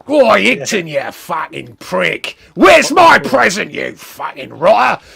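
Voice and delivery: loud, slow Cockney yell; hammed-up Cockney roar, words stretched out; Cockney shout. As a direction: bellow it as an angry Cockney wrestler. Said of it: "Was it Cockney?".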